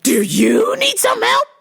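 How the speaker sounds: high, loud and slow; hammed-up shouted echo; mock shout. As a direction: yell the question back rudely. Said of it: "the lady was like".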